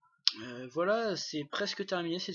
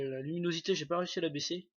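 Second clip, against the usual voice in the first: normal talking voice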